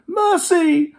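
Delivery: very high terrified cry; cried in fear. Acted. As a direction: beg for mercy in terror. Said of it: "cried Scrooge".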